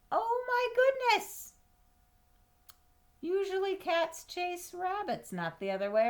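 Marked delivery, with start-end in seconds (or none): 0.1-1.5 much higher squeal of surprise, then drops away; mock astonishment. Reading to a child, amazed that the rabbit beat the cat. 3.2-5.3 high playful aside. Acted. Explaining the surprise to child.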